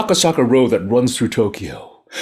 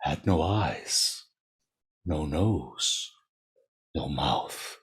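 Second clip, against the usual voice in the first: much lower and softer, slowing to a whisper; hushed reveal; 7 semitones lower, 6 dB quieter, much slower than usual. A narrator's reading. Narrator hushes as the faceless ghost is revealed, soft slow dread. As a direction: drop the voice for the horror reveal.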